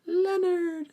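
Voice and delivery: dazed, very high-pitched mock wail; Eugene. Eugene walks into Wit's End in shock, dazed high wailing. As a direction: draw the name out high, as if in a trance. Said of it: "Eugene's just dazed".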